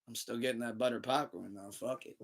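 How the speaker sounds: very soft, half to oneself; hushed retold grumble; resigned mutter. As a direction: act out quietly giving in. Sheepish retold thought about snacks.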